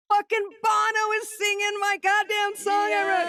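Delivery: very high excited yell; exultant shout. Retold or imitated. Telling best friend that [name] sang the song, exultant shout. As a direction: shout the unbelievable news in triumph.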